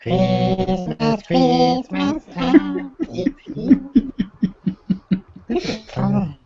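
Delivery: high squeaky chipmunk singing; helium voice. Pretend. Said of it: "Sound like a chipmunk".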